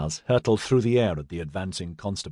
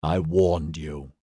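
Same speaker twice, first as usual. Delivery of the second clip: low, slow menacing warning; 6 semitones lower, much slower than usual. A narrator's reading. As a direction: let the threat sink in slowly. Edyard threatening rioters.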